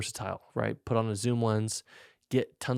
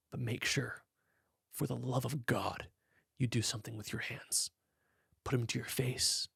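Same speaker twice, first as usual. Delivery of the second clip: soft, hushed, intimate coaching whisper; breathy wedding-day hush; dreamy whisper voice; 10 dB quieter than usual. Shown as a demonstration. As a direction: murmur it like a tender secret to the groom. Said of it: "I hope you enjoyed that little demonstration".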